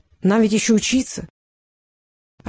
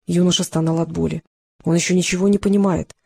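ordinary talk, nothing marked

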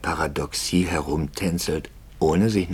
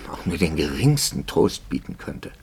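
ordinary delivery

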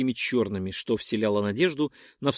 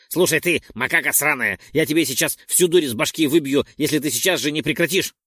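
louder, menacing threat; threatening; 3 semitones higher, 5 dB louder than usual. Acted. Roldon threatens Perk, louder menacing threat. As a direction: threaten him to stop now. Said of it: "пригрозил Ролдон".

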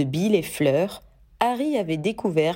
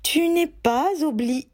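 mother cut off; 8 semitones higher than usual. Acted. Molly voiced, cut off.

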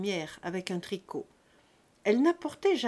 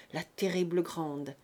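ordinary delivery, nothing marked

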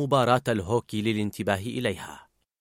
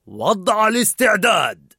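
higher, louder, slow shout; alarm call; 7 semitones higher, 5 dB louder than usual. A narrator's reading. Narrator shouting the alarm, slow. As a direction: call it like a siren.

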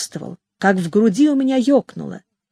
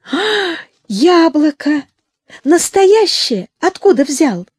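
bright, slow, delighted exclamation; childlike joy; 7 semitones higher, slower than usual. A narrator's reading. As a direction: marvel at the apple like a child. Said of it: "вспыхнула детская радость".

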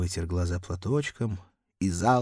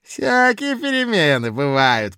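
put-on loud, high drunken bluster; drunken blustering official; 6 semitones higher, 10 dB louder than usual. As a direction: boast it loud like a tipsy clerk. Drunk Kozulin.

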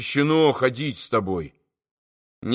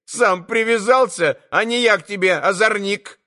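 shouting; 8 semitones higher than usual. A narrator's reading. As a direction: let fly at the boy, up high. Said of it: "раскричалась Марья".

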